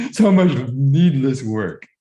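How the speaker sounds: laughing through the words; rueful laugh at herself. Real, not acted. Laughing at own wasted effort.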